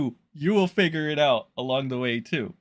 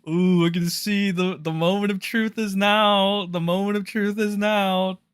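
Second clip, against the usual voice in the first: higher and louder, hyped announcement; 7 semitones higher, 5 dB louder than usual. Real, not acted. Streamer hyped for the moment of truth, excited. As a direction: build the drama of the big reveal. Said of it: "getting so fucking hyped up".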